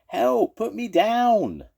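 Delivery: high, loud desperate cry for help; frantic shout. Acted. Toad scooped up by a claw, frantic shout. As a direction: scream it as the claw grabs you. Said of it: "Toad shouted frantically".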